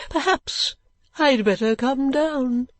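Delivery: put-on high, slow, wavering-pitch old eccentric; old gentleman. Old gentleman giving in to man below, eccentric.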